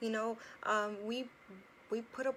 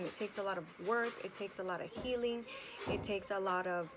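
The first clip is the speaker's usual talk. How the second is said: ordinary talk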